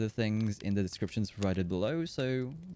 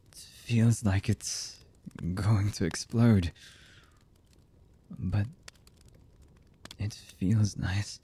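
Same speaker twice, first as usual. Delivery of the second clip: slow, halting lover's voice with long pauses; hesitant, emotional confession; slower than usual. Acted. Lover confessing horrible deeds and love to the beloved, halting and emotional. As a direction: confess haltingly, one fragment at a time. Voice actor's romantic audio roleplay.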